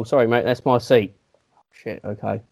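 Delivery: ordinary talk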